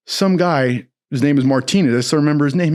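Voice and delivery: normal talking voice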